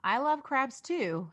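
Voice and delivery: ordinary talk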